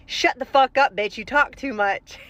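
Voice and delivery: mock-angry blow-up at the person on the line; retold angry outburst. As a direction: imitate a scammer dropping the polite act. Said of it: "his tone went from very professional to".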